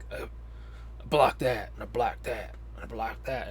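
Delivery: lightly voiced action star, lazily fending off blows; lazy Seagal. Reviewer mocking Seagal's lazy fight scene, mild mock drone. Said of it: "He's like".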